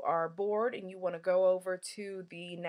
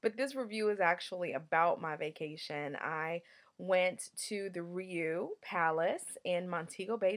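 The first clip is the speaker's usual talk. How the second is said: usual speaking voice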